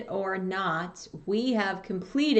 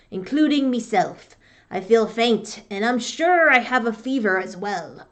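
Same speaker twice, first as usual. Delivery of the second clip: louder, gruff sickly pirate; 5 dB louder than usual. Acted. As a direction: growl it like a feverish old pirate. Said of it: "Here we go with my pirate voice".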